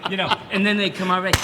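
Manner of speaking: ordinary delivery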